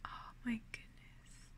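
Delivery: ordinary delivery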